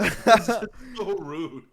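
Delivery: high, slow, loud scandalized gent; mock offended Brit. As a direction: act outraged like a stuffy Englishman.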